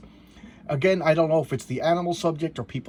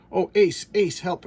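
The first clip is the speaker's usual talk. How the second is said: louder, higher cry for the hero dog; calling for help; 6 semitones higher, 6 dB louder, slower than usual. Acted. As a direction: yell out for rescue. Cat cries for help.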